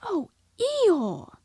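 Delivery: put-on high, loud boy's cry of sympathy; young Christopher Robin voice; boyish call. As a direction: call out to him as a sorry young boy. Boy calls sorrowfully to donkey.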